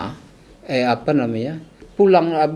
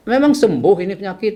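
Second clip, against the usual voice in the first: retold testy challenge, pitched up; a bit annoyed; 8 semitones higher, 4 dB louder than usual. Patient scolding a fellow patient, mildly annoyed. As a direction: challenge him with mild exasperation, higher voice. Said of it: "Saya agak kesel".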